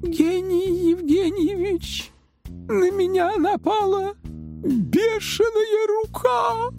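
very high, slow whine; plaintive complaint. A narrator's reading. Revived assistant tells professor of attack, complaining. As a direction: wail it out, dragging every word. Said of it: "пожаловалась девушка".

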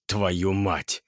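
husky just-woken mutter; hoarse from sleep. A narrator's reading. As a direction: swear with a sleep-roughened voice. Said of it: "Голос был хриплым".

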